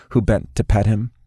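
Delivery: usual speaking voice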